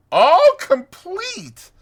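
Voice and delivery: loud, high, drawn-out crow of triumph; triumphant. A narrator's reading. Toad showing off the cart, triumphant. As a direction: boom it out proudly as the toad. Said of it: "said the toad triumphantly".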